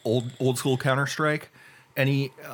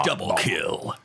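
multiplayer announcer; slower than usual. Retold or imitated. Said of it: "same multiplayer announcer voice too".

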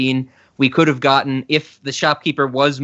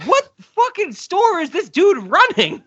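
high-pitched mock bafflement; retold incredulity; 14 semitones higher than usual. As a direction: replay your disbelief, pitched way up. Retells own baffled reaction.

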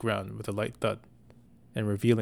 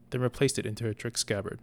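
plain reading voice

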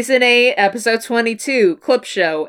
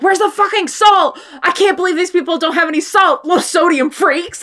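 high, annoyed grumbling rant; mock angry rant; 8 semitones higher than usual. Sam searching the house for salt, hammed-up irritated rant. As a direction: gripe like a frazzled hunter. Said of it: "and he's like".

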